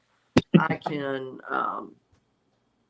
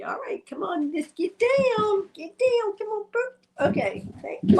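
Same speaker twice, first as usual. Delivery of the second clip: high whispered pet talk. Real, not acted. Owner coaxing her dog to get down, high whisper.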